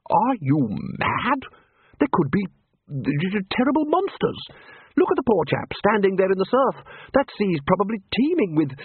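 nervous. A narrator's reading. Frightened wizard voice.

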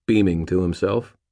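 ordinary delivery, nothing marked

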